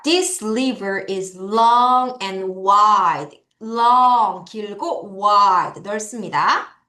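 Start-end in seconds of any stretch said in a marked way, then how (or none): 0.0-3.4 very slow, word by word read-along; slow choral reading. Shown as a demonstration. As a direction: read it slowly so the class can follow. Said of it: "같이 준비 시작". (아주 천천히 또박또박 같이 읽기)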